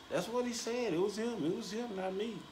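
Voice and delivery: high voice playing innocent; high defensive tease. Real, not acted. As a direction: wash your hands of it in a high squeak. Don't blame the messenger.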